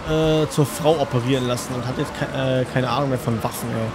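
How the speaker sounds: normal talking voice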